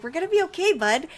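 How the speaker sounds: high, bright-voiced comfort to a nervous kid; reassuring son. Mom reassures her son at the kindergarten gate, high and soothing. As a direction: soothe the little boy at the gate. Holding scared son's hand at school.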